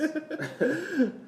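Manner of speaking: high amused laugh; real laugh. Real, not acted. Host laughing at the joke while wrapping up the episode, high amused laugh. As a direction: let a laugh out with your co-host.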